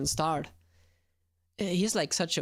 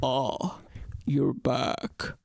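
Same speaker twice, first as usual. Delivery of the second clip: lower, slower character voice, a touch of Sojiro; 6 semitones lower, much slower than usual. Shown as a demonstration. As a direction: drop into a lower, gruff-free Sojiro line. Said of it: "it sounds like Sojiro".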